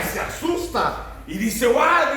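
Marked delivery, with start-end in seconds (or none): 1.7-2.2 a sudden high, loud frightened yelp; startled cry. Retold or imitated. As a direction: cry out like a startled fortune-teller. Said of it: "dice que gritó asustada". (grito asustado y agudo)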